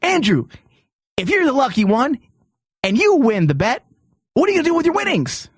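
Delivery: normal talking voice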